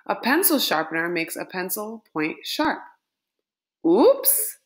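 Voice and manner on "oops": sudden high, loud little exclamation; a playful startled oops; surprised oops. Acted. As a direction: blurt it like something just slipped. Reader blurts a surprised exclamation.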